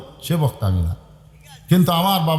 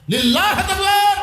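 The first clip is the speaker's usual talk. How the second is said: high, slow, ringing crowd call; rallying takbir call; 14 semitones higher, 4 dB louder, slower than usual. Real, not acted. Preacher calls crowd to takbir.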